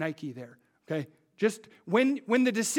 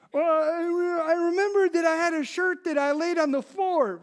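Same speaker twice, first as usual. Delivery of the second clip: put-on higher, whiny little boy's voice; kid's excuse; 10 semitones higher, 6 dB louder than usual. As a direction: plead your case like a child. Son [name] voiced making excuses.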